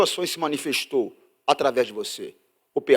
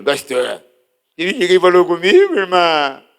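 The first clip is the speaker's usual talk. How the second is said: loud complaining church lady; hammed-up hurt sister; offended sister; 3 semitones higher, 13 dB louder, slower than usual. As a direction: whine the grievance loudly.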